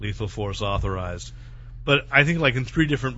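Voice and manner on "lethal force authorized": flat machine announcement; robotic ring voice. Retold or imitated. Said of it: "all the rings said".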